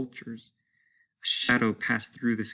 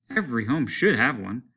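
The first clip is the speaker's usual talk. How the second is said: ordinary delivery, nothing marked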